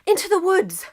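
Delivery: high, loud breathless cry; a high, loud gasped command. Acted. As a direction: gasp it out while running. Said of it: "gasped England".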